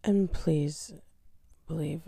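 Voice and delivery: normal talking voice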